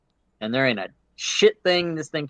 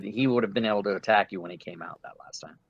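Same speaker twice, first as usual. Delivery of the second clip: whispered aside; 6 semitones lower, 6 dB quieter, faster than usual. Real, not acted. DM aside, rules correction.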